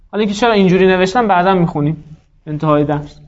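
ordinary talk

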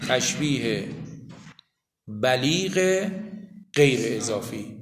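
slow dictation with long pauses. Real, not acted. Teacher telling students what to write under the line, slow dictation. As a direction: dictate word by word, drawing each one out. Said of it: "زیر اون خط بنویسید".

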